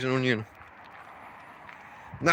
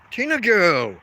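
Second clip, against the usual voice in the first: louder sharp call to the dog; 4 semitones higher, 5 dB louder, slower than usual. Real, not acted. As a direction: call her name out firmly. Calling the dog mid-story.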